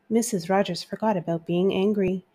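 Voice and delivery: usual speaking voice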